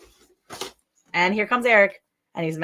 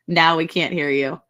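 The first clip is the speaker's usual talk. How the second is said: ordinary talk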